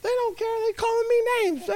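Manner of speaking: put-on very high, whining upset little boy; whiny kid voice. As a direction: squeal it like a sulking child. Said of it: "I said".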